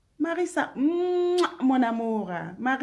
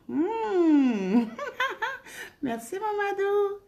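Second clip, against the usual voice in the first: laughing; 9 semitones higher, slower than usual. Real, not acted. Thanking a viewer, laughing.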